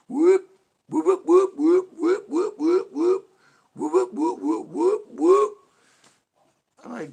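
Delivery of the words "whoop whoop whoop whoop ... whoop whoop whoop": very high, loud whooping siren. Retold or imitated. Mocking cops who love their sirens, loud whoops. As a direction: belt out the siren, whoop after whoop. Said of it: "love doing the whole".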